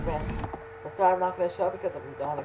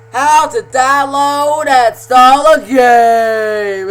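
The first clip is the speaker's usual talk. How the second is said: slow, booming title announcement; announcer voice; 8 semitones higher, 18 dB louder, much slower than usual. Acted. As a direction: call out the show title big and slow. Announcing show title again.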